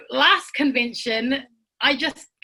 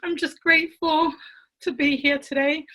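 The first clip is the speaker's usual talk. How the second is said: high, halting voice, fighting back tears; overcome, struggling to get the words out; real emotion, near tears; 3 semitones higher than usual. Real, not acted. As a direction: choke up with gratitude, pausing to hold it together. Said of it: "I got so emotional".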